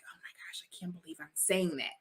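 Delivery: hushed, breathy disbelief at her own words; shocked whisper. Retold or imitated. Woman recalling her first plea for help, whispering in shock. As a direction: whisper it like you can't believe you said it.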